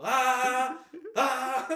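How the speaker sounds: high loud mock scream; imitated screaming engine. Podcaster imitating the American car screaming, high loud mock scream. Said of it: "someone's just holding it, and just like".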